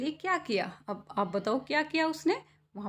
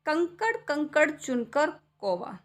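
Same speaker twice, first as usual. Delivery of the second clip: slow, sing-song कंकड़ कंकड़ recital; poem recitation; 4 semitones higher, slower than usual. Acted. Poem line. (कविता पाठ का लहजा)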